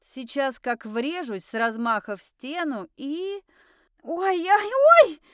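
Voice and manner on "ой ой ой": high, loud, drawn-out squeal; squeaked yelp. A narrator's reading. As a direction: squeal it out as if crashing. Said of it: "пискнула я".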